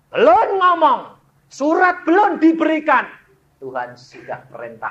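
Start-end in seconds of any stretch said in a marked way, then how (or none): 0.1-3.1 fast, high, loud preaching cry; preaching at full force. Acted. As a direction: drive the story home with a fervent cry. Preacher's emotional climax.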